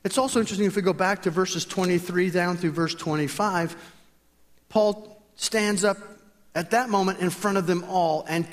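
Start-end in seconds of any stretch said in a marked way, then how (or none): none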